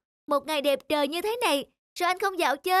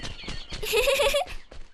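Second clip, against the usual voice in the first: bird giggle; 8 semitones higher than usual. Acted. Bird laughing happily.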